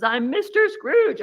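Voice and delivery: high, slow, gleeful shout of the answer; gleeful reveal. Retold or imitated. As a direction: crow out the answer like a giddy game winner. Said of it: "he just bursts out laughing".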